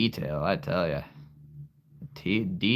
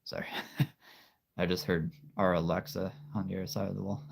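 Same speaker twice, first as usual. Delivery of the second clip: normal talking voice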